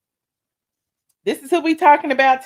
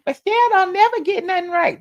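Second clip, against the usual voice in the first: higher whiny voice imitating critics; mock complainer; 8 semitones higher, 3 dB louder than usual. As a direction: mimic the haters mockingly. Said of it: "people be complaining".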